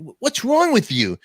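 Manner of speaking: high, loud mock reprimand; manager's scolding. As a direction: act out a manager telling off staff. Manager scolds clerk in retold story.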